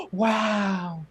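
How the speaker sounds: imitating a high gasp, then an awed wow; a hammed-up gasp of wonder at birds; amazed gasp. Guest retelling first noticing the birds, mock awe. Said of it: "I'm like".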